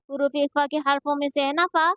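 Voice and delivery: ordinary delivery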